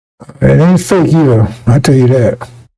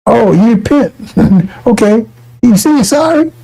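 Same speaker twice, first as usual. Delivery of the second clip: higher, scoffing mock judge; 6 semitones higher than usual. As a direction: play a judge who doesn't buy the apology. Judge mocking the repentant criminal.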